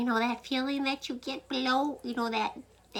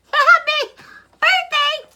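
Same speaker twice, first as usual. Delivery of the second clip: very high, loud "Happy birthday!"; surprise shout; 14 dB louder, slower than usual. Acted. Dinner guests shouting to the wife, joyful shout. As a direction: yell it like a surprise party crowd.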